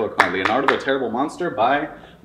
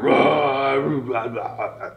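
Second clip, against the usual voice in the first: monster roaring; 3 semitones higher, much slower than usual. Acted. Monster giving it all to scare Sam, roaring. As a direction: roar at the boy, petering out. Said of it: "He went".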